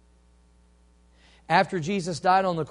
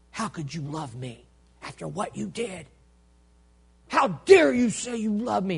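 angry rebuke; 3 semitones higher than usual. Acted. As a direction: scold him, building to an outraged cry. Imagined rebuke Peter feared from Jesus.

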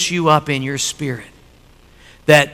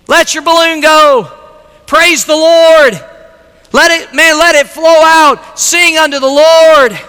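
exuberant preaching; 12 semitones higher, 7 dB louder than usual. Real, not acted. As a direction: belt it out to rouse the congregation. Preaching at full force.

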